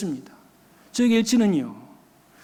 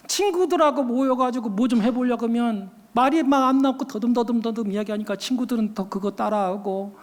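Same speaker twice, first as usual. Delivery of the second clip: normal talking voice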